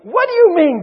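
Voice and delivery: loud, high indignant retort; creditor's incredulous outburst; acted outrage. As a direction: cut the beggar off in anger. Creditor rejects plea, grabs debtor.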